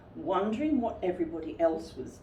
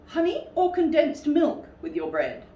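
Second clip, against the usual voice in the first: higher, louder fussy Rabbit; Rabbit's voice; 9 semitones higher, 7 dB louder than usual. Acted. Rabbit welcoming Pooh into his house, higher. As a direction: fuss over the guest.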